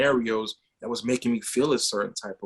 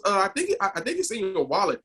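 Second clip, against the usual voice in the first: imitated young son; 7 semitones higher than usual. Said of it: "And he goes".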